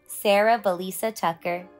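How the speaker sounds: plain reading voice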